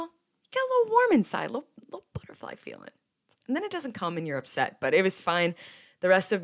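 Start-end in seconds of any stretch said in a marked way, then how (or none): 0.0-1.5 gushing delight. Real, not acted. Dreamy about menu cheese. 1.5-2.9 a lovesick whisper, quick and breathy; whispered flutter. Real, not acted. Dreaming about the mozzarella on the menu, whispered.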